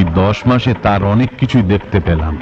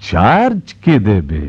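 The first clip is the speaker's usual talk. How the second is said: very slow, drawn-out sarcastic repeat; mocking echo; 3 semitones lower, much slower than usual. Father repeating his son's excuse, mocking. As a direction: stretch each word of the excuse scornfully.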